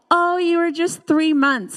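ordinary talk, nothing marked

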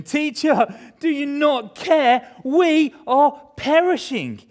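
high, slow, loud plea; desperate cry. Acted. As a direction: cry out as if drowning in a storm. Disciples cry out to Jesus.

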